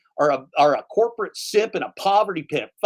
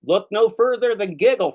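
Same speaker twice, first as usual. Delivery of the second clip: raised-pitch parody of a sponsor read; sarcastic sales pitch, pitched up; mocking ad echo; 5 semitones higher, 3 dB louder than usual. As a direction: parrot the ad slogan with scorn. Mockingly parrots the played ad.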